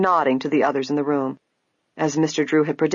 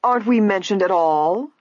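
higher, indignant interruption; insistent, affronted question; sharp; 5 semitones higher, 4 dB louder than usual. A narrator's reading. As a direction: break in sharply. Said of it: "broke in sharply".

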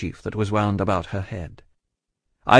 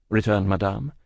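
plain reading voice

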